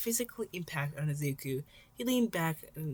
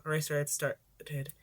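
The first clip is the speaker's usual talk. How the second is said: ordinary delivery, nothing marked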